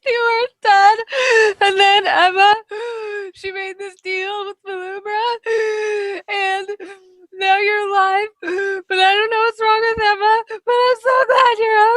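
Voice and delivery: high, sobbing with gasps; crying relief. Acted. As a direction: blurt it out between sobs. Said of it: "roll for pretty or ugly cry".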